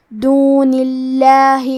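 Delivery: slow chant, the lam of اللہ in دون اللہ kept thin; thin, light lam. Shown as a demonstration. Teacher shows tarqiq, chanting دون اللہ with thin lam. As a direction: recite "dunillahi" with a light l in اللہ. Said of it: "ترقیق سے مطلب پتلی آواز".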